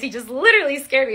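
fast, laughing through the words; real giggle while explaining. Real, not acted. Laughing after kid's scare.

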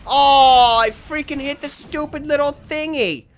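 loud, high cry of frustration; loud drawn-out "Oh", then high complaint; exasperated wail. Real, not acted. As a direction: wail over your own blunder. Exasperated at own misfire.

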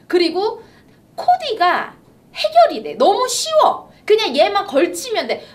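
high, eager sales pitch; infomercial. Real, not acted. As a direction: sell it with bright excitement. Excited live shopping pitch.

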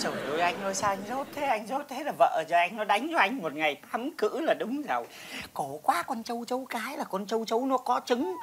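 low, quiet exasperation; hushed, low-pitched reproach; soft chiding. Acted. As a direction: gently scold him under your breath. Softly chiding man's ignorance.

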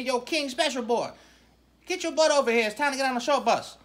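ordinary talk

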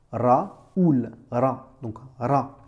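Raoul then Ra, R trilled, slow; rolled R. Shown as a demonstration. Teacher modelling Raoul and ra with a rolled R. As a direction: roll the R in Raoul and Ra. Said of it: "Comment tu dirais?".

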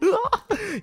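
very high-pitched laughing squeal. Real, not acted. Friend counting seven hits out of thirty, squealing. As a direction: squeal at the friend's poor score. Reacts to being teased about score.

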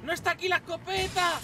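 alarmed shout. Real, not acted. Gamer finding the shotgun gone mid-fight, shouting in alarm. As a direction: yell it as the weapon isn't there.

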